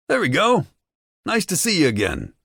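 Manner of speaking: high, gleeful taunt; mocking cheer. A narrator's reading. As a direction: cheer him on like a game. Henchman cheering the captive.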